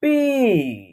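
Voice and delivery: "पी" with a long held vowel; pronunciation demo. Shown as a demonstration. Teacher showing how the letter is pronounced, "पी" drawn out. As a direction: draw out "पी", falling at the end. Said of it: "इसका उच्चारण करेंगे".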